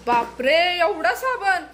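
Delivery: high, loud laughing बापरे at the soap; laughing shock. Real, not acted. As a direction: gasp and laugh at the suds. Mother sees too much soap. (हसत आश्चर्याने ओरडणे)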